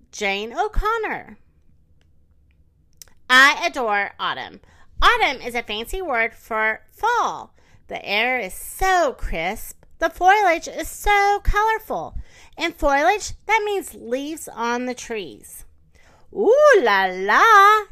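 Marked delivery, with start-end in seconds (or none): 3.3-15.7 slow, animated picture-book narration; expressive story time delivery; reading to children. Acted. 16.3-17.8 loud, drawn-out swoop of delight; delighted exclamation. Acted. Narrator's delighted exclamation in story.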